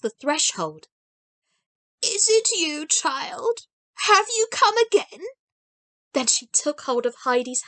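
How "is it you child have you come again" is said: put-on higher, hopeful voice of a frail old woman; an old blind grandmother reaching out, hopeful; old grandmother's eager greeting. A narrator's reading.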